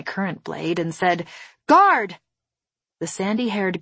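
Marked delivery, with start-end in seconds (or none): 1.7-2.2 loud, high call for a guard; urgent raised-voice summons. Acted. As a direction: call out sharply to summon help.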